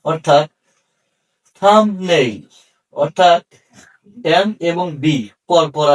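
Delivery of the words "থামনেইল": থাম্বনেইল said as "tham-nail", no B; silent B. Shown as a demonstration. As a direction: model থাম্বনেইল slowly and clearly, M straight into N. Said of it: "বি এর উচ্চারণ".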